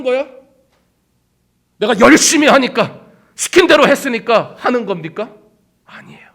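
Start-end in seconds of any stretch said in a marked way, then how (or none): 5.8-6.3 a breath of a whispered no. Real, not acted. Preacher denying it to the congregation in a whisper. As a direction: whisper it, barely there. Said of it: "whispered denial".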